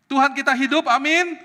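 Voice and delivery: high, loud call for amen; ringing preacher's exclamation; rallying shout. Real, not acted. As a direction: rouse the congregation to answer. Rallying congregation for louder amen.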